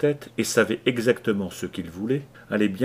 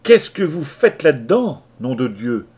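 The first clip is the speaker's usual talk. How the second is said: loud, high angry call; shouting down; 4 semitones higher, 9 dB louder than usual. Acted. Latimer yelling down the hatch at the brawl. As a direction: bellow down through the hatch. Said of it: "cria Latimer".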